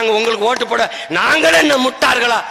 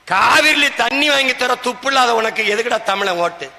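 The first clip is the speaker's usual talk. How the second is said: ordinary talk, nothing marked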